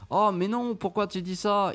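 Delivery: high, faux-innocent protest; mock indignant scammer. Mimicking the scammers denying it, high and faux-innocent. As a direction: play the scammers acting offended. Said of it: "vous voyez".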